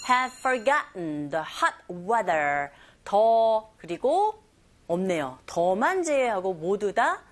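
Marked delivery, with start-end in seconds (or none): 0.1-2.7 slow, drawn-out English phrase; slow pronunciation model. Shown as a demonstration. Teacher models the second listening point, slowly. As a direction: stretch it out for learners. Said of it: "second listening point".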